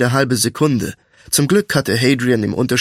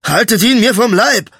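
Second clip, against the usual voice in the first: high, loud cry of alarm; alarmed shout; 9 semitones higher, 5 dB louder than usual. A narrator's reading. Butcher begging bystanders to hold off his attacker, alarmed shout. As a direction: call out for protection. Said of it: "rief der Fleischer".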